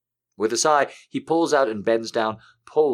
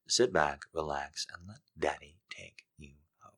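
seductive low voice; 4 semitones lower, 9 dB quieter than usual. Real, not acted. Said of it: "trying to butter you up".

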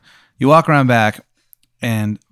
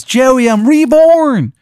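high, very slow, loud falsetto; reborn Steve; 8 dB louder, much slower than usual. Acted. GM voicing revived Steve greeting Joey, high slow falsetto. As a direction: announce it in a squeaky drawn-out voice. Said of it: "he goes".

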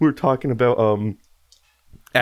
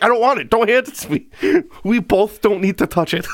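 exasperated refusal; 6 semitones higher than usual. Retold or imitated. Retells his refusal again.

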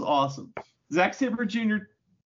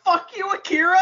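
high, loud mock-outraged yell; shrieked comic comeback; indignant retort; 6 dB louder than usual. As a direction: yell it back in hammed-up outrage. Fan retorts to wrestler's insult.

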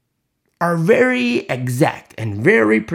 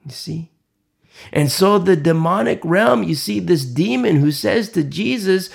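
usual speaking voice

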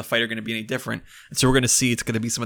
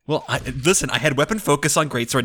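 normal talking voice

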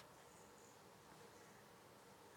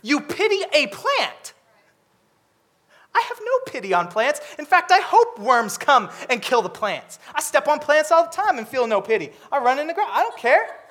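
raised, lightly played God voice; a hint of God mocking the pity; voicing God; 6 semitones higher than usual. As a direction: paraphrase God speaking to Jonah, higher. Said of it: "God says".

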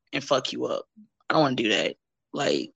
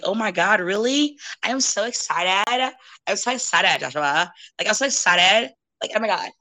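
mock excitement; 3 semitones higher than usual. Woman answering a wrestling come-on, sarcastically thrilled. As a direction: gush with obvious sarcasm.

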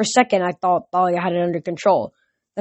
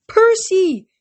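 high excited cry of a name; excited call; 12 semitones higher, 6 dB louder than usual. Acted. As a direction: call out in happy relief. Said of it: "Grover said".

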